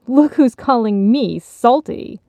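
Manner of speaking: drawn-out, indignant retort; scoffing. A narrator's reading. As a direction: throw it back scornfully. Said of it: "nineteen seventies nostalgia scoffed".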